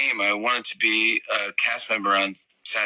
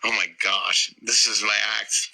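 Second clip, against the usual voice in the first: normal talking voice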